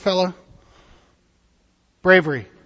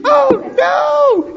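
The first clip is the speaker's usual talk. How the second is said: hammed-up frightened cry, much higher and louder; mock scream; 8 dB louder than usual. Voicing the screaming disciples for the children, hammed-up cry. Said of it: "They were screaming".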